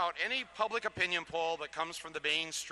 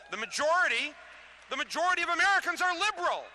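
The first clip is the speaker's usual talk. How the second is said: rousing climax; 11 semitones higher, 4 dB louder, faster than usual. Real, not acted. Rally speech climax.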